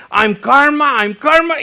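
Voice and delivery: high, loud mock shout; hammed-up high-pitched bellow; imitated yelling. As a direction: holler it repeatedly like the ranting driver. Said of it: "me empezó a gritar".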